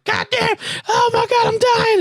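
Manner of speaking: very high-pitched comic wailing; squealing, hammed-up agony; mock scream. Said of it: "just making me scream".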